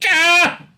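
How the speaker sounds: sudden high yelp of frustration; a short squeal of despair; high exasperated yelp. Real, not acted. Host yelping at a gibberish article, exasperated yelp.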